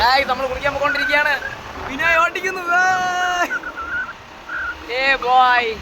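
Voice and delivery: loud excited call from the road. Real, not acted. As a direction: shout it out over the wind. Calling out from moving vehicle.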